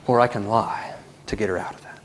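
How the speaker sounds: hushed whisper of temptation. Acted. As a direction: breathe it like a secret. Mom's secret temptation.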